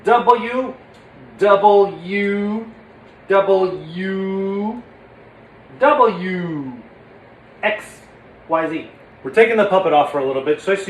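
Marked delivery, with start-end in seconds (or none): 0.0-0.7 "W" high, said out syllable by syllable; syllables emphasized. Shown as a demonstration. Puppeteer showing lip sync, "W" high with each syllable clear. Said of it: "Did you catch W?". 1.4-6.7 "W" stretched out with a long final vowel; drawn-out syllables. Shown as a demonstration. Puppeteer repeating for lip sync, "W" drawn out by syllables. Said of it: "Did you catch W?".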